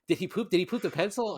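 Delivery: mock-worried, pitched well up; anxious girlfriend. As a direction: play the fretting partner asking fast. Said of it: "she's like".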